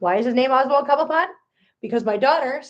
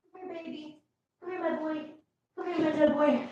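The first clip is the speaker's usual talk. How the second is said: soft, hushed coaxing, much quieter and pitched up; coaxing the cat; 5 semitones higher, 8 dB quieter than usual. Real, not acted. Owner softly calling her hiding cat over to the camera, hushed and coaxing. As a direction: gently coax a shy cat closer.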